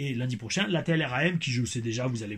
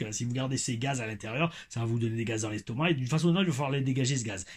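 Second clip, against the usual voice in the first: ordinary delivery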